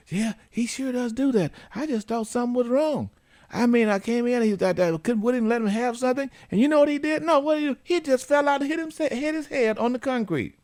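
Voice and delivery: high, eager mock mom voice; imitating the mother. Host voicing the caller mom telling the story, high. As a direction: play the mother explaining, pitched well up. Mother answering in retold conversation.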